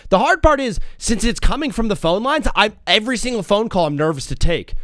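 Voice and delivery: usual speaking voice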